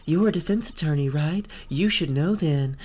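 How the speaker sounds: dramatic posh American. Acted. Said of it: "posh American".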